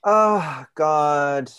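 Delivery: calling out loud and high in frustration; loud exasperated groan. Acted. Mom stuck in the empty pool, loud exasperated cry. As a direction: wail it out, fed up.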